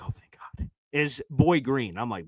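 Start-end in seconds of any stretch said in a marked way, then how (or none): 0.0-0.7 relieved whisper. Retold or imitated. Relief on hearing the second option.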